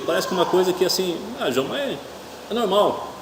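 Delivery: high, dismissive mock voice. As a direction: parody a careless shrug. Mocking the culture's attitude.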